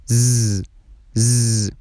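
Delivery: "ز" as a long buzzing z without a vowel; voiced z sound. Shown as a demonstration. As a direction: hold the voiced buzz of "ز". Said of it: "تلفظ سوم".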